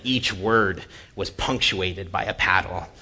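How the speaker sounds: normal talking voice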